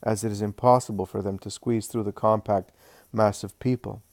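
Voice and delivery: usual speaking voice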